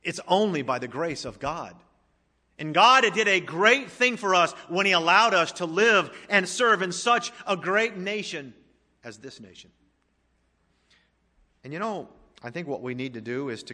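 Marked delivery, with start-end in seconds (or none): 2.6-8.5 fervent sermon climax; preaching at full force. Acted. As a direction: drive each question home to the congregation. Preaching about a great nation.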